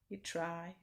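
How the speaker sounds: ordinary delivery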